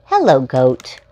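bright, sing-song picture-book reading; reading to children. Acted. Host reading a picture book to young viewers, storytime voice. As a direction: read it out like storytime for little ones.

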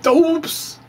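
high squeaky put-on oops; mock surprised oops. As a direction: play it like a cartoon gasp of dismay. Comic refrain after galaxy instability.

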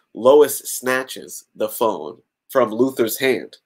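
ordinary delivery

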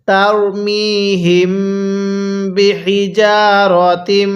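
slow, drawn-out chanted recitation; Quran recitation. Shown as a demonstration. Teacher reciting the joined words for students. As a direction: intone each word slowly. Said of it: "আমার সাথে মিলিয়ে পড়ুন".

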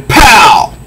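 punchy comic-book pow. Acted. Narrator punching out the sound effect, high and sharp. Reading comic fight aloud.

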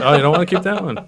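loud, high, laughing protest; laughing tease. Real, not acted. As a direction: tease through real laughter. Teasing about the drawing amid laughter.

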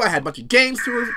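usual speaking voice

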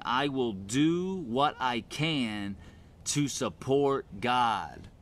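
slow, weighted declaration; deliberate declaration. Acted. Preacher restating faith as a personal pledge, slow and deliberate. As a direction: state it slowly, word by word.

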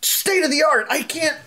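high whiny panic; high panicked kid. Acted. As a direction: whine about the haircut. GM voices panicked student.

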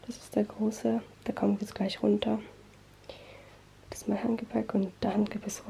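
hushed so brother can sleep; whispering. Real, not acted. (flüsternd)

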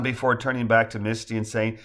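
ordinary delivery

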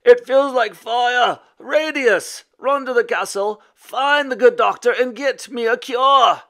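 high, loud pleading in pain; agonized wail. A narrator's reading. Stricken knight sending his son for a cure, wailing. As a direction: stretch the words out in a pained shout. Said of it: "he wailed".

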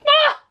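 sudden high yelp. Real, not acted. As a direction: let out a sharp startled yelp.

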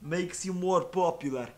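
hammed-up stupid Russian accent; thick mock Russian accent, played dumb; fake Russian accent. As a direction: do a silly, over-the-top Russian meme voice. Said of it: "speaking with a stupid Russian accent".

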